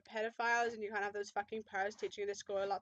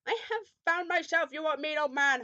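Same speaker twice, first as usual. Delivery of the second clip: high, loud hammed-up yell; 5 semitones higher, 9 dB louder than usual. A narrator's reading. Hagrid talks back to the old man, loud acted yell. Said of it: "Hagrid yelled".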